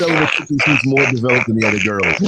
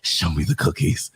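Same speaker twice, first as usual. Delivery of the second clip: lightly voiced low, slow sultry line; a hint of a seductive drawl; put-on voice; 11 semitones lower, 5 dB quieter, slower than usual. Co-host teasing a guest, low slow put-on voice. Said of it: "That did not sound, you put the voice on".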